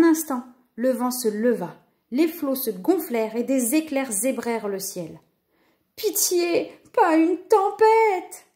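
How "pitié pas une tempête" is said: high, drawn-out moan of dread; wailing plea. A narrator's reading. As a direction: wail for mercy, stretching the words. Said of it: "gémit Lou".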